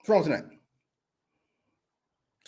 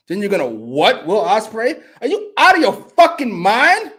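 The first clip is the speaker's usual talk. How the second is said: rising, loud, incredulous outrage; almost losing it; 6 semitones higher, 8 dB louder than usual. Real, not acted. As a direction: build to a high, slow, outraged question. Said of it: "Almost lost it".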